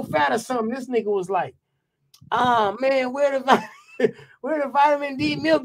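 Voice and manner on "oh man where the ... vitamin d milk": mock whiny complaint, slightly higher; a lightly voiced grown man whining like a baby. Mocking a houseguest whining for vitamin D milk, lightly babyish whine. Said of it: "start whining like a little baby".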